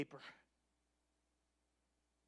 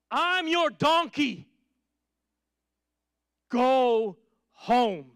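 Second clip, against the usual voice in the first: high, loud, slow pleading donkey voice; donkey's plea; 7 semitones higher, 8 dB louder, much slower than usual. Acted. Balaam's donkey pleading with its master, high and loud. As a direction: play the donkey begging its master.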